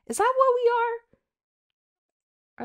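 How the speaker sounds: high, slow drawn-out question; mock doubtful squeak; incredulous. Real, not acted. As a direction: stretch it out in disbelief. Doubting her own word.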